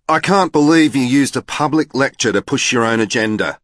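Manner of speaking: speaking loudly. A narrator's reading. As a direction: call him out loudly in public. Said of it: "She was speaking quite loudly".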